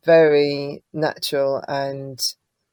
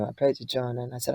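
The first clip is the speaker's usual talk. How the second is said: usual speaking voice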